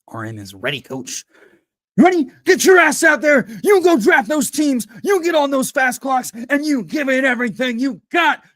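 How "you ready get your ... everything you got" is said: high, loud fired-up yelling; coach's pep shout. Pretend. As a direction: scream them onto the field like a coach.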